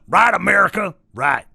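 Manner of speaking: high, loud, harsh rallying cry; shouted call. Pretend. Ranter demands America agree, shouted call. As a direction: shout it out to the whole country. Repeats the call with more force.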